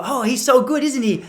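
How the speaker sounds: lightly imitated excited Japanese fan; high, admiring mock quote. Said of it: "the Japanese people kept looking at us".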